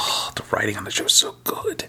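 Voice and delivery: soft unvoiced whisper. Real, not acted. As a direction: breathe it out fondly. Whispered fond aside.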